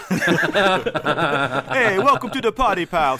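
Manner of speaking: mock Italian accent. Host quoting the movie line to a co-host in mock Italian accent. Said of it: "See, I'm not Italian. That was racist".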